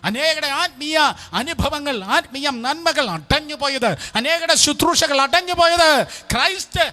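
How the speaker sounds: high, loud exhortation, coming down in pitch at the end; full-force preaching. Real, not acted. Preacher calling listeners to repent, full-force preaching. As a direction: shout the warning to the congregation.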